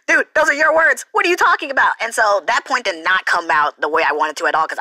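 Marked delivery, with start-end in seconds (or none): 0.1-1.9 baffled, scolding himself; incredulous. Retold or imitated. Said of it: "I was like".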